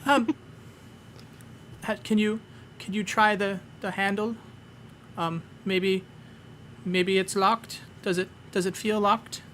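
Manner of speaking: high, timid questioning kobold voice; a small creature nervously offering ideas; hesitant kobold Meepo. Acted. Meepo suggests trying handle.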